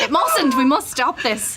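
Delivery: mock alarm. Mock plea against dethroning Nemo.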